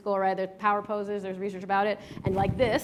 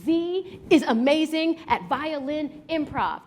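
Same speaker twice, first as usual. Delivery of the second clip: higher-pitched, slow, declared affirmation; proud affirmation; 6 semitones higher, slower than usual. Shown as a demonstration. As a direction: declare it out loud, slowly and proudly. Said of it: "chest out loud in the mirror".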